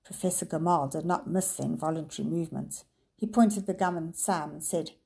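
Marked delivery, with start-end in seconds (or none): none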